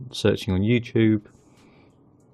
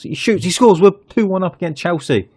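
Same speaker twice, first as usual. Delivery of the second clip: much higher and louder, jubilant; goal celebration; 8 semitones higher, 6 dB louder than usual. Real, not acted. Manager cheering the goal against Chelsea, jubilant. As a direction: shout it like a commentator calling a goal.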